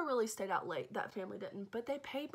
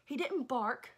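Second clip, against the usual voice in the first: higher and louder, building indignation; indignant rant; 7 semitones higher, 4 dB louder than usual. Real, not acted. Babysitter venting at the dog, high indignant rant. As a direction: rant at the dog rising in pitch.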